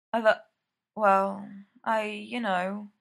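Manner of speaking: halting sleepy slur; slurred. Acted. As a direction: slur it, trailing off drowsily. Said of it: "slurring gently".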